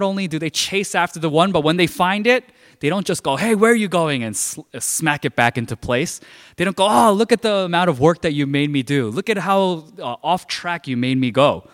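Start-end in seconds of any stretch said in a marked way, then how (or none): 3.4-4.2 high-pitched indignant call; mock scolding. Shepherd to the stray sheep, mock scolding. 6.9-11.7 higher-pitched exasperated griping; mock complaining. Shepherd blaming the found sheep, mock exasperation.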